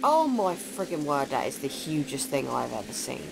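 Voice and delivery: usual speaking voice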